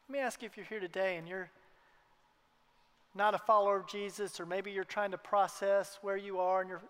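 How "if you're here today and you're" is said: usual speaking voice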